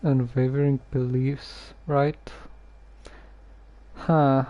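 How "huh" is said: normal talking voice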